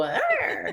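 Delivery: high, laughing mock pirate arr; pirate growl. Mother imitating cute Minnie Mouse growling, laughing mock arr. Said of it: "hearing Minnie go".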